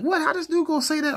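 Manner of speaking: very high, loud, squealing disbelief; outraged disbelief. Retold or imitated. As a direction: lose it in shock at what he wrote. Retold outrage at the insult.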